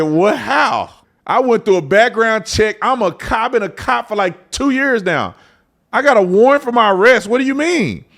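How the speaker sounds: high, loud stunned protest; outraged disbelief. Retold or imitated. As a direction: cry out in shock. Outraged about warrant for arrest.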